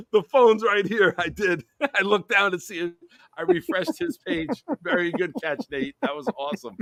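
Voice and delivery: high, giggly and breathless; can't stop laughing while explaining; giggling. Real, not acted. Host caught by co-host, giggling explanation.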